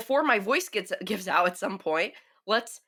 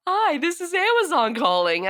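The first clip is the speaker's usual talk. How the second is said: robocall voice; 7 semitones higher, 5 dB louder than usual. Retold or imitated. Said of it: "it'll probably just be like".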